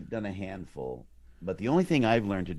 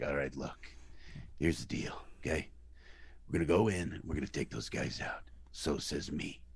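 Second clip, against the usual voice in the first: low, soft, half-whispered threat; tough mob boss; 5 semitones lower, 6 dB quieter than usual. Shown as a demonstration. Mob boss briefing his crew, low intimidating hush. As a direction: drop it down and lean in menacingly. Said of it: "learn to manipulate your voice".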